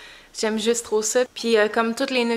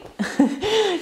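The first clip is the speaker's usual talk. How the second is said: light laugh; 8 semitones higher than usual. Real, not acted. Laughing with the baby.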